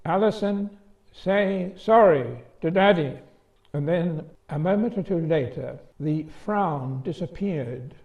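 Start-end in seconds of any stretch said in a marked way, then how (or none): 0.0-3.2 "Alison" high, "sorry" hit hard and loud with a falling end; big sister stressing "sorry" loud and high; tremendous emphasis. Retold or imitated. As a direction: spell out each word of "say sorry to Daddy" as a final command. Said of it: "with a tremendous emphasis".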